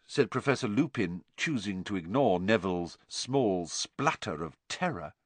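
ordinary delivery

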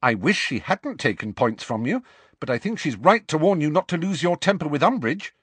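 high, earnest reasoning voice; earnest Hermione. Acted. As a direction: reason firmly with an angry friend. Hermione reasons with angry friend.

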